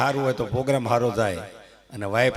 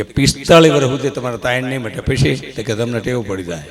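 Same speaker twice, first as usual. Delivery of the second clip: mock loud verdict; 10 dB louder than usual. Punchline of astrologer's verdict.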